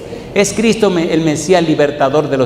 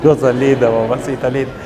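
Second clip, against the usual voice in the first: laughing tender aside; 3 semitones lower than usual. Real, not acted. Preacher breaks off to his mother, fond and chuckling.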